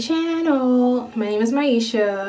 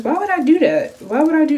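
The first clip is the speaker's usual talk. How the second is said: pitched-up disbelief at a smell; high disbelieving; 6 semitones higher, 3 dB louder, faster than usual. Real, not acted. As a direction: squeal it in disbelief. Reacting to a strong smell.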